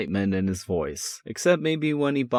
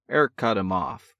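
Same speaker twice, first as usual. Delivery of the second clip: ordinary delivery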